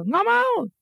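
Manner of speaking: tinggi, merengek menolak; rengekan anak kecil. Retold or imitated. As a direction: jadi anak kecil yang ngotot. (anak merengek tidak mau)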